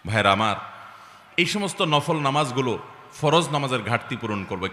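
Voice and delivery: ordinary talk, nothing marked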